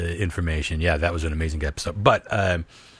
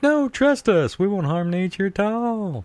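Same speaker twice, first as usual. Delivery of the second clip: very high, put-on innocent voice; drawn-out falsetto false promise; mock reassurance; 12 semitones higher than usual. Voicing companies denying harm to nature, mock-innocent reassurance.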